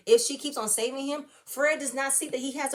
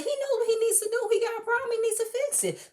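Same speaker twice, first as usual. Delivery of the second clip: exasperated; 12 semitones higher, 3 dB louder than usual. Retold or imitated. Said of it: "I said".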